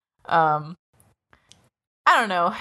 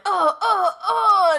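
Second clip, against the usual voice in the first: imitated kicking cries; 11 semitones higher, slower than usual. Imitating characters kicking a man.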